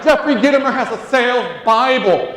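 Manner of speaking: big stage shout; shouted announcement. Real, not acted. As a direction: sell it like a revelation. Hyping up the crowd.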